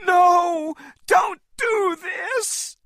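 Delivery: very high, slow desperate shout; crying out. A narrator's reading. Anne begging them to stop, a very high shout. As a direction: plead at the top of her voice. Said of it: "Anne cried".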